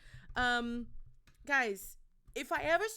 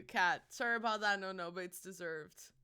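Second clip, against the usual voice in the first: usual speaking voice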